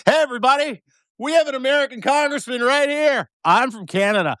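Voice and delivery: high-pitched mock announcement to a bar; calling out to everyone. Re-enacts announcing congressman to whole bar.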